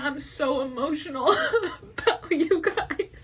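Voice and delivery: laughing through tears, overwhelmed; laugh-crying. Real, not acted. Pregnant creator thanks her followers, laughing through real tears. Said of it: "I'm not even trying to cry".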